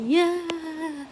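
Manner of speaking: high cheer from the whole class. Acted.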